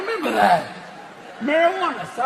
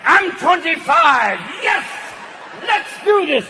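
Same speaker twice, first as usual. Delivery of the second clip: cocky penis hype; 5 semitones higher than usual. Acted. Penis cheering on the old man, pumped yell. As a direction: hype yourself up like a young stud. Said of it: "your penis is like".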